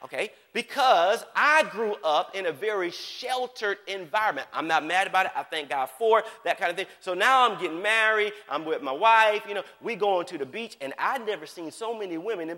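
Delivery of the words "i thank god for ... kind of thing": normal talking voice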